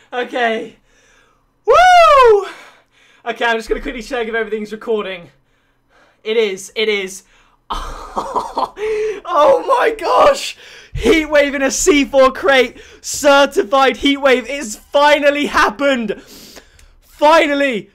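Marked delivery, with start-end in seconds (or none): 1.7-2.5 very high, loud drawn-out woo; whoop of joy. Real, not acted. Player celebrating the crate win, high loud whoop. 7.7-17.9 high, loud, slow exclamations; overjoyed shouting. Real, not acted. Player exulting that heat wave finally happened, high slow shouts. Exulting over crate win.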